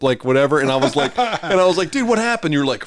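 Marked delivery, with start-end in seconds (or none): 1.9-2.5 high-pitched, acted-out astonishment; mock-alarmed, pitched well up; incredulous question. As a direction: ask it high, like a baffled buddy. Acting out himself asking the friend.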